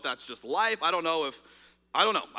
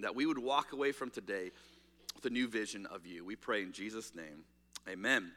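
soft, earnest prayer that grows quieter; 4 semitones lower, 4 dB quieter than usual. Acted. Pastor praying over the congregation, hushed and earnest. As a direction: pray humbly, fading toward the amen.

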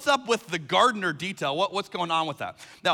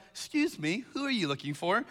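playful feigned ignorance; 6 dB quieter than usual. Retold or imitated. Jesus teasing a distraught, confused Mary at the tomb, feigned innocence. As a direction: ask it quietly, playing innocent. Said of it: "can't imagine he could say that with a straight face".

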